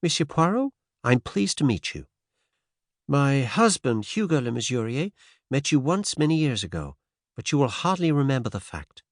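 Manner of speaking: put-on faint transatlantic American accent; light American lilt, lifted pitch. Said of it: "She spoke with a faint transatlantic accent".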